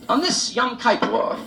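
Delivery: hammed-up rabbi chanting to his flock; mock rabbi sermon. Rabbi's new sound mimicked.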